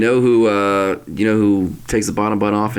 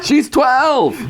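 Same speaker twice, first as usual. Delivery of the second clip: high mocking sitcom voice. Voicing sitcom character.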